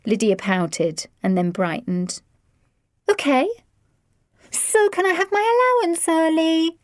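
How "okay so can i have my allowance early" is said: put-on wheedling teen. A narrator's reading. Teen asks for allowance.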